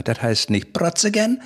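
Protze gern said slowly and high; wrong name shown. Retold or imitated. Correcting the Berliner, Protze gern drawn out slowly. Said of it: "er sagt".

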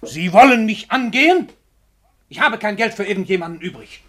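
high, loud, affronted question; indignant outburst. Acted. Famous writer rebuffing a visitor he thinks is begging, indignant. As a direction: bristle at the idea of being asked for money. Writer suspects a request for money.

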